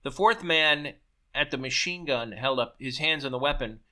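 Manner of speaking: ordinary delivery, nothing marked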